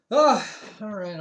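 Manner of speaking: loud slow pleased ah all right; excited drawn-out ah. Real, not acted. Gamer pleased with himself, loud drawn-out ah. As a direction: savour the moment, drawn out.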